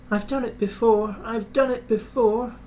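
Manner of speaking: an experienced engine puffing up the slope; puffing Edward. A narrator's reading. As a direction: chug it calmly, an old hand. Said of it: "puffed Edward".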